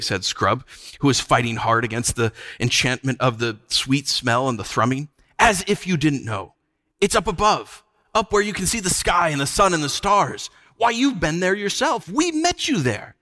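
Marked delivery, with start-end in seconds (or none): 5.4-13.2 high, climbing insistence; exasperated protest. A narrator's reading. Scrub reminding the witch they met above, insistent. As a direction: press the obvious truth, ever higher.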